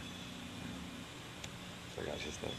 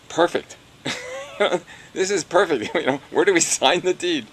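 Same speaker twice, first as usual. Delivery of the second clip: excited, laughing; 7 semitones higher, 8 dB louder than usual. Retold or imitated. Excited retelling of buying.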